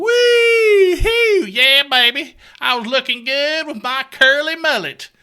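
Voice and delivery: very high, loud party whoop; wild whoop. Acted. Host bragging about his old perm, loud high whooping.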